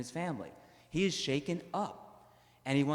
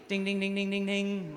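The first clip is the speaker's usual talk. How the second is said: bell ding imitation; 7 semitones higher than usual.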